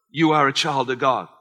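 ordinary delivery

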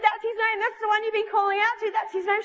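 high, insistent retold reassurance; excited confirmation. Preacher confirming to the girl who she's been calling, high and excited. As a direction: confirm it eagerly, pitched well up.

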